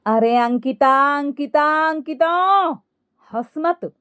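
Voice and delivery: mother-in-law trying to hush her, pitch climbing; scolding. A narrator's reading. Mother-in-law scolds laughing daughter-in-law. (डाँटते हुए)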